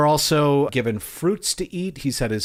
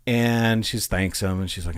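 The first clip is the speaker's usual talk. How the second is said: normal talking voice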